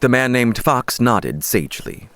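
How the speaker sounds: plain reading voice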